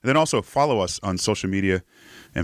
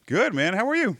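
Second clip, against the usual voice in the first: real involuntary leap into a much higher pitch; voice jumping up; 13 semitones higher than usual. Real, not acted. Host greeting the young guest, voice jumping up high. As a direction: greet with your pitch shooting way up. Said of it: "my voice just went up a few octaves there".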